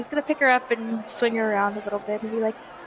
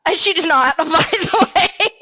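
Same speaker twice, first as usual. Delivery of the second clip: loud, high aside breaking into laughter; laughing admission; 6 semitones higher, 6 dB louder than usual. Real, not acted. Player admitting the character has no experience, laughing. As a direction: laugh through the confession.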